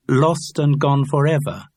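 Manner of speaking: "Lost and gone forever." with a lilting, half-sung fall; sing-song intonation. Acted. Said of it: "you were almost singing it".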